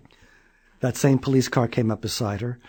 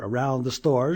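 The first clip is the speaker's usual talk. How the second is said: usual speaking voice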